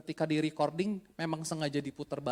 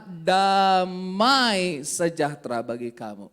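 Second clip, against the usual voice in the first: solemn proclamation; 8 dB louder, slower than usual. Acted. Quoting Jesus solemnly.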